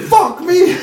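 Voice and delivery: high, loud amazed outburst; amazed exclamation. Retold or imitated. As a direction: blurt it out in shock. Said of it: "I was just like".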